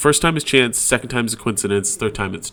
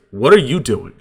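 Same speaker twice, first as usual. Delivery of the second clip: louder, pointed "what are you doing"; confrontational tone; 6 dB louder than usual. Shown as a demonstration. Same words to your spouse, different message, loud confrontational tone. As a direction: demand it, hitting the words hard. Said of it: "different message".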